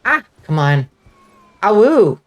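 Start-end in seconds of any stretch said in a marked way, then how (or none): 1.6-2.2 high playful wolf howl; a short mock howl. Howl after the wolf-job joke.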